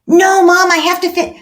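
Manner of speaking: put-on high, loud child's objection; protesting child. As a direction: plead loudly like an interrupted kid. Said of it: "I go over to Jason".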